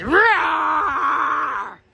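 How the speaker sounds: hammed-up storybook roar; troll roar. Troll popping up to frighten Billy, mock roar. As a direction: roar to scare the goat.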